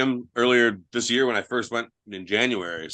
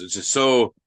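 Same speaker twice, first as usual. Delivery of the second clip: normal talking voice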